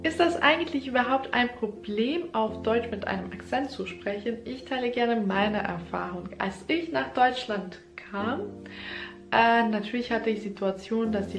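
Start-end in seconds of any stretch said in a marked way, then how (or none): none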